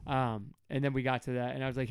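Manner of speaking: normal talking voice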